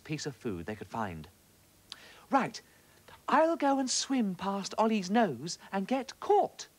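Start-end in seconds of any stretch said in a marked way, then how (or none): 2.3-6.7 high, slow and determined; a small fish bravely setting the plan going; brave little fish. A narrator's reading. Little fish telling the others her plan, high and slow. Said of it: "said one little fish".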